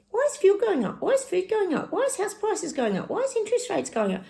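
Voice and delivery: high-pitched, indignant mock questions; indignant questioning. Host voices people asking about rising prices, high and indignant. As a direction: rattle off questions in a high, exasperated voice.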